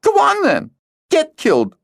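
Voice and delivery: put-on a crying girl pushes her friend away; tearful outburst. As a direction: hurl it at him, hurt. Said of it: "she cried, tears streaming".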